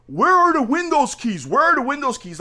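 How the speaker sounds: high, insistent mock-angry demand; imitating angry customer. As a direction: hammer the question like an irate buyer. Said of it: "he's literally cussing me out".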